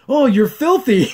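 mock over-friendly greeting; imitating Scorpia. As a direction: gush it through a laugh.

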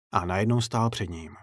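ordinary delivery

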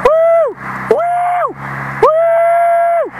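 very high drawn-out woo; whooping. Real, not acted. Vlogger making noise for fun, high whooping. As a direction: let out piercing high whoops.

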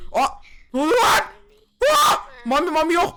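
very high, loud scared yelling; panicked shout. Real, not acted. Player hit by a jump scare, panicked shouting. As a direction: yell out in sudden fright, pitch shooting up.

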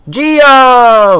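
loud high delighted shout; excited. Real, not acted. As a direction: cheer at the loot. Finding currency.

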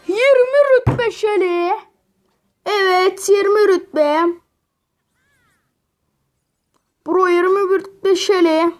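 high, loud triumphant cheer; excited. Real, not acted. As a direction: celebrate the win. Reaching rank twenty celebration.

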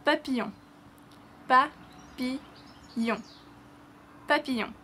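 papillon said pa-pee-YON; French papillon. Shown as a demonstration. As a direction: papillon repeated for learners.

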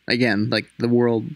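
ordinary delivery, nothing marked

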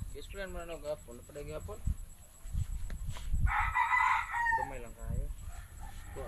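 whispering while hiding. Real, not acted. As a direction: keep it down so nobody hears. Hiding, whispered group instructions.

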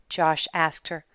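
usual speaking voice

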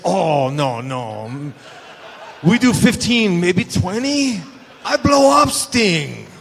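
hammed-up panicked Japanese accent, half laughing; broken English in a put-on Japanese accent; Japanese accent imitation. Imitating Muta continues.

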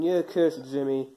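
normal talking voice

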